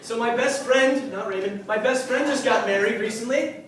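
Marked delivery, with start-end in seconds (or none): none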